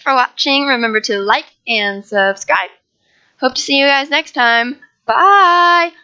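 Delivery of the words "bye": cheerful bye. Real, not acted. Outro sign-off.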